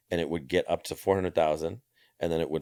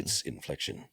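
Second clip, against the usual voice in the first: "inflection" dropped low and softer; low, softer demo of the phrase; lower inflection; 4 semitones lower, 6 dB quieter than usual. Shown as a demonstration. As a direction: bring the pitch down on "inflection". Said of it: "It's just inflection".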